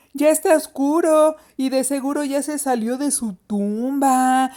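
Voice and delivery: scare the little ones, stretching the ghost's name; mock-eerie big-sister teasing; spooky taunting. A narrator's reading. Sister scares siblings with ghost story.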